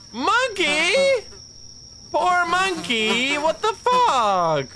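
high, stretched shocked cry. Real, not acted. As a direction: squeal it out in disbelief. Shocked at dead monkey.

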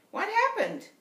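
put-on high boyish question; curious older brother. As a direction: pitch up into a boy's voice. Older brother asks in story.

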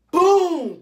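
high, loud, drawn-out boom; explosive imitated blow; punch sound effect. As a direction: shout it as the impact of a hit.

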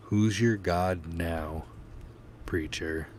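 slow, menacing question. Acted. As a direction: lean in and threaten him slowly. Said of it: "Ethan asked menacingly".

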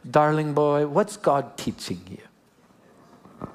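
imitated New Zealand accent, slowing right down and softening; Kiwi accent. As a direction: drop into a gentle, drawn-out Kiwi accent. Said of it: "that little Kiwi accent".

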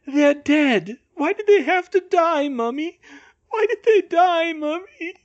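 put-on high, childlike crying wail; delirious. A narrator's reading. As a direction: wail for mummy, loud then fading on the name. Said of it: "Bill sounded delirious".

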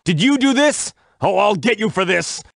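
high-pitched, outraged challenge; angry accusation. Acted. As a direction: confront the culprit and threaten payback. Confronts the culprit, threatens revenge.

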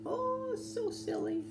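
very high, slow coo; playful amusement. Real, not acted. Teacher reacting to the silly cow, high and playful.

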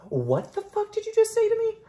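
outraged squeal at the doll; shrill mock outrage. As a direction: shriek it in fake indignation. Shrieked repeat at doll.

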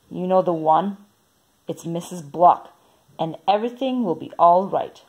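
ordinary delivery, nothing marked

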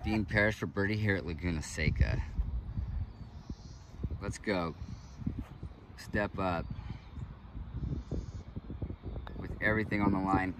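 hushed TV golf commentary; golf commentator. Acted. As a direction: call the shot like a broadcaster.

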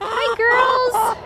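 high sing-song greeting to the chickens; sweet, high-pitched call to the hens. Real, not acted. Greeting her chickens again.

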